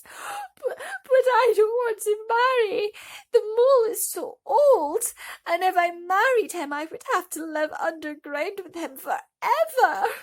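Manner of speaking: high, loud, crying protest; tearful protest. A narrator's reading. Thumbelina refuses to marry the mole, crying loudly. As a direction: wail it through tears. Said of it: "burst into tears".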